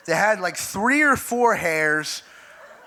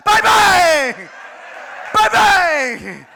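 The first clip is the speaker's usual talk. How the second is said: very loud, high, explosive bursts; mimicked shout; 10 semitones higher, 8 dB louder, much slower than usual. Comic copying his roommate's method on audience, shouted bursts. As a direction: fire off each one as a high holler.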